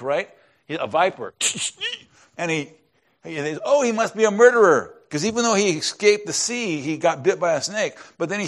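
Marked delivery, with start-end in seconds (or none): 3.6-4.9 mock alarmed exclamation, higher and louder; a touch of shocked gossip; alarmed islanders. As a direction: play the startled islanders jumping to a conclusion. Islanders react to snake bite.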